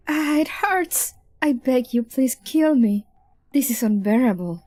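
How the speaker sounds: mock agony. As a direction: play at begging through pain, stretching the words. Acted torture victim begging for death.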